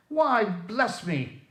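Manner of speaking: high, very slow drawn-out exclamation; delighted cry. A narrator's reading. Fred hearing his uncle at the door, surprised cry. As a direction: stretch out the surprise joyfully. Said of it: "cried Fred".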